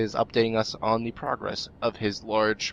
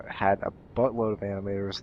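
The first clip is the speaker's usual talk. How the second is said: ordinary talk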